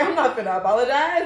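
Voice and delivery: loud, laughing don't-care; unbothered. Retold or imitated. Mom saying she no longer apologizes for baby, laughing and loud. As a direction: declare it with a laugh. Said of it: "And now I'll just be like".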